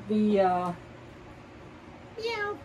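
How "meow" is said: high, slow cat meow; playful meow. Retold or imitated. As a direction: meow like a kitten. Mimics a cat.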